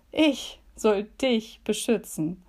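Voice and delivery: slow, snorting big-cat voice; a lightly voiced leopard, snorting slowly. A narrator's reading. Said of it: "Der Leopard schnaubte".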